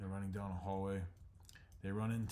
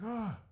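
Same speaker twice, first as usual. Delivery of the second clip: high loud yelp of alarm; startled cry; 6 dB louder than usual. Acted. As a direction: jump at the collapsing trees. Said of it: "He's like".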